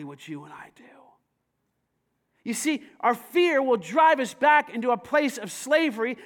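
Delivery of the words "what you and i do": sudden confiding whisper. Real, not acted. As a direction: drop to a whisper to draw them in.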